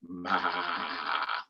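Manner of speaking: low, soft bleat; a lazy goat call. Acted. Reading to kids, a low soft bleat.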